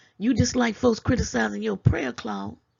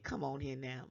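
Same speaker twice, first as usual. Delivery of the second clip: soft aside; 6 semitones lower, 14 dB quieter than usual. Real, not acted. Tag after challenge.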